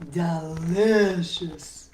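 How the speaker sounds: slow, drawn-out, relishing the taste; greedy sheep savoring. Acted. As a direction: stretch it out like a greedy sheep licking its lips. Sheep savoring stolen plants.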